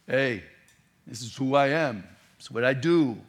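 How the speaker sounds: lightly done Brooklyn tough guy; a touch of Brooklyn swagger; Brooklyn accent. Retold or imitated. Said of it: "in a Brooklyn accent".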